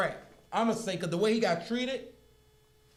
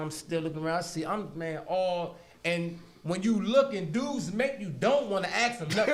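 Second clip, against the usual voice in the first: usual speaking voice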